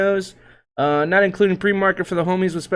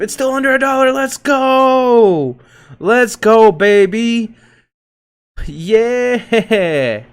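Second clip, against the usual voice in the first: mock cheer; 5 semitones higher, 6 dB louder, much slower than usual. Real, not acted. Gloating cheer at euro falling.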